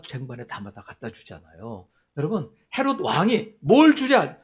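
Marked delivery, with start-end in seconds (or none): 3.6-4.4 loud, high-pitched royal question; kingly booming offer. Acted. King Herod offers a reward, loud and high. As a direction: call it out grandly, like a king.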